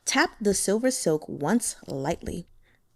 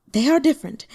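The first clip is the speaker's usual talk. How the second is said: trembling, high and louder; shaky voice; 5 semitones higher, 5 dB louder, faster than usual. A narrator's reading. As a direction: voice shaking as she insists. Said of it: "she said in a shaky voice".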